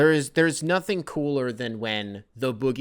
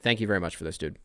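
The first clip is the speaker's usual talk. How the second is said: normal talking voice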